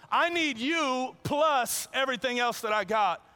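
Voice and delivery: high, loud, self-satisfied bragging; boastful rich young man. Pretend. Preacher voicing the rich young man bragging to Jesus, high and loud. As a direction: puff up like a smug rule-keeper wanting praise.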